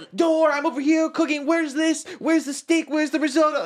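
high, loud frantic kitchen shouting; hammed-up shouting line cook in a busy kitchen; mock chef yelling. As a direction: bark orders like a stressed chef. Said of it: "screaming at each other all the time".

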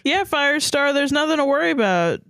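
high, chirpy mock reassurance; mild cheer. A narrator's reading. Graystripe covering up for Firestar, high mock cheer. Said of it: "cheered Graystripe".

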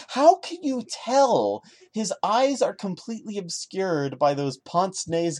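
sarcastic question. Real, not acted. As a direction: ask it with heavy irony. Sarcastic jab at the story.

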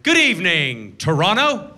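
big stage hello, drawn up high on the city; show opening greeting. Retold or imitated. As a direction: greet a huge theater like a host. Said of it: "start the show like this".

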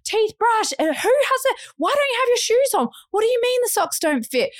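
high-pitched, harried nagging; frantic mum. Retold or imitated. Mother rushing her children out the door, frantic. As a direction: rattle off questions like a harried mother. Retelling a frantic morning rush.

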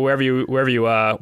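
normal talking voice

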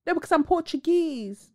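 prissy refusal to be called African; mock insistent denier. Mocking a woman who denies her Angolan roots.

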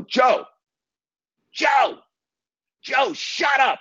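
retold scream over the speakerphone; screaming at him. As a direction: shriek "Joe, Joe, Joe, shut up" to stop the caller. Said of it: "I scream at him".

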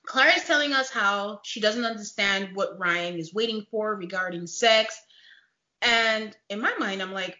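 usual speaking voice

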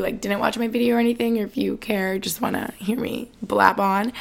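ordinary talk, nothing marked

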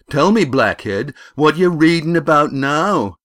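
loud, high-pitched sneering bully; bully boy, jeering. A narrator's reading. Bully taunting his rival about his one-eyed teacher, loud jeer. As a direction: pelt your rival with jeering questions.

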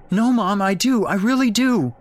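put-on high, loud little-boy wailing; distraught wail. A narrator's reading. Boy insisting to his mother he does, wailing. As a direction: wail in protest like an upset child. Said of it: "Jake wailed".

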